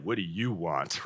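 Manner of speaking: low, slow and flat-toned, annoyed; curt, cold reading. Shown as a demonstration. Pastor showing how the verse is often misread, coldly. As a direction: read it like an irritated brush-off. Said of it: "sometimes we read that and it's like".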